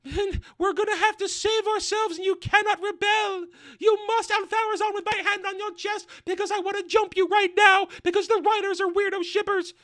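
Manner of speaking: shrill high crying queen, hammed up; mock queen voice. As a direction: wail it like a melodramatic queen. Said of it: "And she goes".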